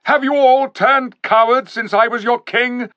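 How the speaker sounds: high, loud scornful challenge; angry rebuke. A narrator's reading. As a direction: shame them with a loud rebuke. King rebukes hesitant animals.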